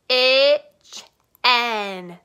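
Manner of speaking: Kitchen broken into two loud beats, kitch-en; syllables clapped. Shown as a demonstration. Teacher clapping syllables of kitchen, loud and split. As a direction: punch each syllable of Kitchen. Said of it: "let's clap it out".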